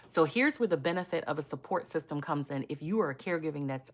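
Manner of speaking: ordinary delivery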